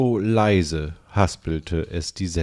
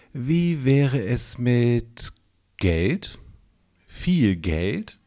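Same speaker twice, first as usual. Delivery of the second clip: hesitant question; 4 semitones higher, much slower than usual. A narrator's reading. Udo proposes money tentatively.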